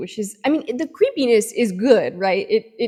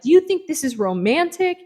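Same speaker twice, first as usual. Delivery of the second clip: mock-angry confrontation, pitched up; angry accusation; 7 semitones higher, 3 dB louder than usual. Freaked-out Sam charges at the handyman, mock angry. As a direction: accuse him in playful, acted outrage. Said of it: "she charges at him angrily".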